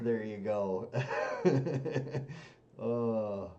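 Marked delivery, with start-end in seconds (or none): 0.9-2.5 loud laugh. Real, not acted. Laughs after teasing.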